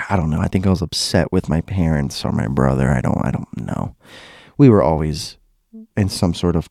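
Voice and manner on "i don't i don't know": muttered aside. Real, not acted. Unsure aside to self.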